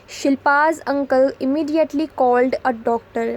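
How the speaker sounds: slow, word by word, for the class; slow dictation read. Shown as a demonstration. Teacher reading an example sentence to students, slow and deliberate.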